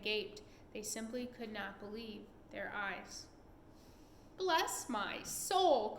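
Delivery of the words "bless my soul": slow, higher astonished cry; cried out. A narrator's reading. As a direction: draw it out in shock. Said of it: "cried the fire department head".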